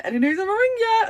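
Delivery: bright eager phone question; eager high ask. Retold or imitated. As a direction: chirp it up high like an eager customer.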